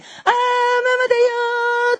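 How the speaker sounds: very high, slow cooing mother voice; a mother cooing back to her baby, very high and slow; mother's sing-song reply. Retold or imitated. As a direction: coo it like a delighted mother.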